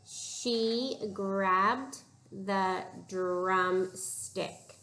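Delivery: each word stretched out very slowly; slow careful reading. Shown as a demonstration. Teacher slowly reading a sentence aloud to her pupils. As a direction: read it word by word, slowly, for the class. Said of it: "I'm gonna read this sentence".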